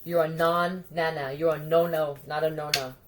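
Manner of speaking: ordinary talk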